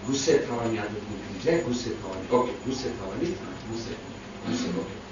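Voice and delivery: hushed murmur fading to a whisper; whispered prayer. Retold or imitated. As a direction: pray under your breath, fading out. Praying silently at prayer. (મનમાં ધીમેથી ફફડાટ)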